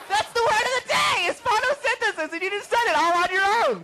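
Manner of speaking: high, shrieking excitement; excited screaming. Acted. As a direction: scream with over-the-top joy. Word-of-the-day celebration.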